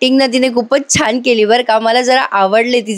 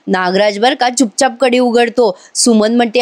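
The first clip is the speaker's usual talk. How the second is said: ordinary delivery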